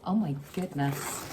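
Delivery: ordinary talk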